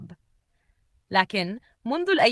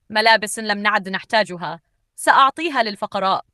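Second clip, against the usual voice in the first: plain reading voice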